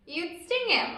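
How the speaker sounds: ordinary delivery, nothing marked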